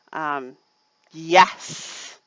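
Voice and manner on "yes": loud, emphatic yes; excited yes. Real, not acted. Host raving about a show preview, excited. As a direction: punch out each yes with relish.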